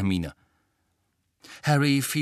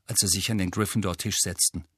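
usual speaking voice

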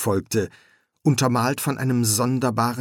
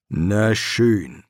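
ordinary delivery

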